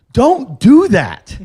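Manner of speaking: slow, loud, firm don't do that; stern warning. Retold or imitated. Friend warns him not to bring them on stage, slow and firm. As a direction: draw it out like a final warning.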